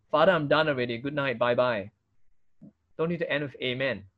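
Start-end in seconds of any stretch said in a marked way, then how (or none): 0.1-1.9 low, fading throwaway prayer; not bold. Acted. Someone ending a prayer to the Father, half-hearted. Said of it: "If your prayer is not bold". 3.6-4.1 low, soft, throwaway Amen; not bold. Shown as a demonstration. Preacher showing a needless prayer ending, half-hearted. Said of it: "If your prayer is not bold".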